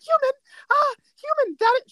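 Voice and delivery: high, loud panicked sputtering; hammed-up frantic yelping; mock panic. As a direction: act out a cartoon character's alarm. Acting out Batty's panic at humans.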